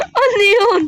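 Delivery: startled yell. Real, not acted. Startled by Lola.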